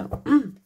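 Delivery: clears a cracking throat; quick throat clear. Real, not acted. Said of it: "J'ai la voix qui casse".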